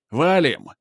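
yelled loud and urgent; shouted. Acted. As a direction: shout it to flee. Крик тревоги, против тихого. (крикнуто)

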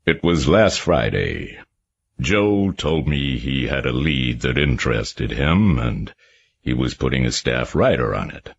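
put-on mild reluctance in a Harvard accent; a wary witness telling the detectives reluctantly. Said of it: "he went on reluctantly".

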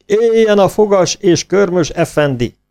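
high, loud, slow cheer; cheering shout. A narrator's reading. Turkish soldiers cheer the clawed effendi. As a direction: hail the hero together. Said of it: "kiáltották".

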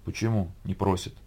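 soft, low voice; small, low query; subdued question. A narrator's reading. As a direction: ask it quietly after a rebuke. Narrator's subdued question after rebuke.

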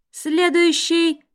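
slow, high call over a crackling mic; crackling microphone announcer. A narrator's reading. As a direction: announce it slowly through a microphone. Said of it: "объявляет потрескивающий голос в микрофон".

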